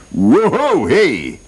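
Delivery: high-pitched hollered whoop; hammed-up excited holler. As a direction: whoop it up like an eager hunter. Hunter's whoop in story.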